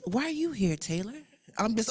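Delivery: normal talking voice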